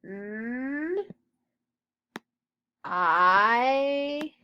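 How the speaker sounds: N as a long rising nnn, I as a long drawn eye; sounded out slowly. Shown as a demonstration. Teacher sounding out knife while writing, drawn-out sounds. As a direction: stretch N and I, pausing between. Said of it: "hear a N sound".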